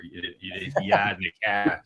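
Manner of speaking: Boston accent, yard and car with no r, yahd and cah. Shown as a demonstration. Host jokes about a Boston accent, mock Boston accent. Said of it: "I get Boston".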